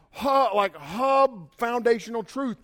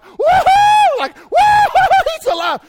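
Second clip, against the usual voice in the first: very high, loud whooping cheer; celebrating; 14 dB louder, slower than usual. Pretend. Disciples cheering that Jesus is alive, whooping. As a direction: whoop it like a party. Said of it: "They should have been like".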